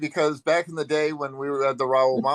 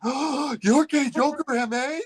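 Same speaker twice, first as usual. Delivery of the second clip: much higher, amazed fan voice; starstruck gasp; 14 semitones higher than usual. Retold or imitated. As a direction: gush like you've met a celebrity. Woman recognizes his screen name.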